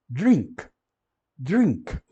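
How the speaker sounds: "drink" with a clear i, then the n, then k; separate I and N. Shown as a demonstration. Teacher demonstrating I then N, emphatic English "drink". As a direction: sound the i before the n in "drink". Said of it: "Tem que ler o I e depois o N".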